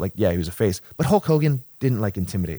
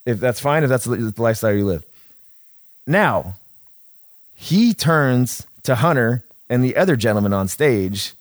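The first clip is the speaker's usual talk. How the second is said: ordinary delivery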